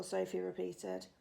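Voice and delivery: ordinary delivery, nothing marked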